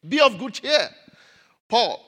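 loud, high proclamation; proclaiming loudly. Acted. Preacher calling the verse out to the church, loud. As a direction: call it out to the whole church.

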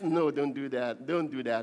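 low, slack, half-hearted telling-off; careless warning. Shown as a demonstration. Parent admonishing a child without conviction, careless. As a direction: toss the warning off low, without any force. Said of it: "Admonition is not just some careless".

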